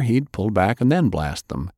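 ordinary delivery, nothing marked

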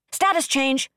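high, urgent call-out from a tracking rating; sudden announcement. A narrator's reading. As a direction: call out a sudden alert, pitched far up. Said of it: "one of his tracking ratings announced suddenly".

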